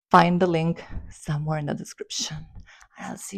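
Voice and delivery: quick hushed whisper. Real, not acted. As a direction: whisper it fast, like a secret. Aside telling where the link is.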